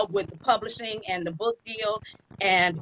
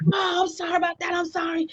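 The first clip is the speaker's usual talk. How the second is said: apologetic brother; 9 semitones higher than usual. Retold or imitated.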